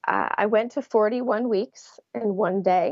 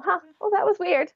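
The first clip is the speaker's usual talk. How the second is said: high, puzzled huh; mildly baffled musing to herself; puzzled thought; 10 semitones higher than usual. Retold or imitated. Recalling the first odd twinge, puzzled. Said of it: "I just thought".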